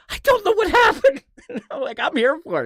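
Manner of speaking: high, loud, laughing it out; can't believe it, cracking up; real laugh through the words; laughing disbelief. Real, not acted. Laughs at the absurd feud.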